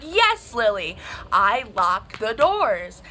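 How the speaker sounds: loud, drawn-out mock irritation; exasperated mom. Mom answering daughter's repeated question, loudly exasperated. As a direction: answer loudly, fed up with being asked.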